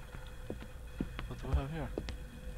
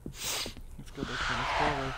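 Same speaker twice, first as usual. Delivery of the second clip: whispering; 4 semitones lower, 6 dB quieter than usual. Real, not acted. Repeats it in a whisper.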